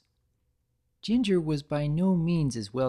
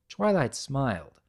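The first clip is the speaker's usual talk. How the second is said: ordinary delivery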